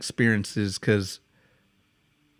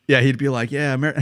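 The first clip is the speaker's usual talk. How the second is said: ordinary talk, nothing marked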